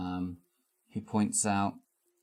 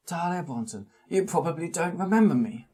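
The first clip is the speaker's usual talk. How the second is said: put-on small old man voice; 9 semitones higher than usual. GM voices Charles Adams.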